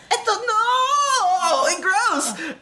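high, drawn-out cartoon "no"; hammed-up high wail of disgust; mock disgusted wail. Player voicing Toby covered in spider goo, mock wail. Acts out webbed Toby's disgusted cry.

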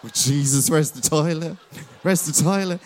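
imitating a jittery host backstage; mock nervous panic. Host imitating nervous Rebecca backstage, mock jittery panic. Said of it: "She's like this".

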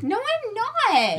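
high, slow, whiny denial; high playful denial. Pretend. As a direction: deny it in a high whine.

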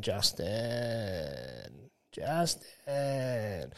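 mildly voiced, soft, moaning name calls; a hint of a rocking woman's lament; distressed woman calling. Retelling the supermarket woman's soft moaning call. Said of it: "rocking back and forth, going".

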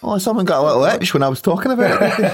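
laughing tease. Real, not acted. Teasing friend amid laughter.

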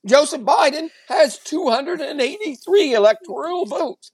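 patronizing anchorman lecture, emphatic and over-enunciated; talking down. Acted. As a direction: explain it as if to a moron. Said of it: "he talks to you like you're a moron".

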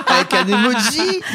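breathless from laughing; high, laughing voice; after laughing hard. Real, not acted.

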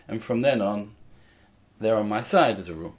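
normal talking voice

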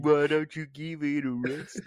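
slow, drawn-out East London complaint; mock East London moaning; East London accent. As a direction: grumble slowly in exaggerated East London. Continuing the mock East London bit.

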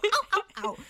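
high playful wolf howls; silly werewolf howl. Acted. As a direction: yip like a little werewolf. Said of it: "silly little werewolf howl".

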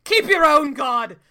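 mock villain shout. Quoting Bison's villain line.

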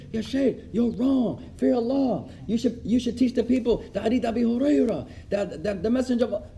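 retold brother scolding the sheikh in front of everyone; mock yelling. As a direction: wag a finger at the sheikh in a voice. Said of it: "screaming, yelling".

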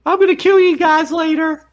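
squeaky villain voice; high mock threat.

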